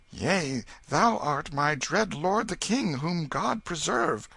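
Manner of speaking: bow and answer in awe; boy humbly addressing the king; frightened pauper boy. Acted. Tom answers king fearfully.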